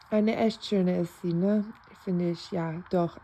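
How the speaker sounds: ordinary talk, nothing marked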